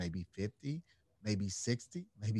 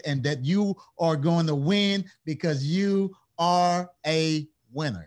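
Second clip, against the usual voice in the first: raised, louder preacher's rally; preaching; 4 semitones higher, 8 dB louder than usual. Acted. Host rallying listeners to face their pain, preaching fervently. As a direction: fire them up to face their Goliath.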